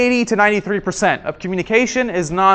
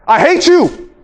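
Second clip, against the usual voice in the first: shouted I hate you, loud and high; angry shout; 7 semitones higher, 5 dB louder, slower than usual. Shown as a demonstration. Demo to the class, shouted in anger. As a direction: yell it at them in fury. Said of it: "your words do not really matter".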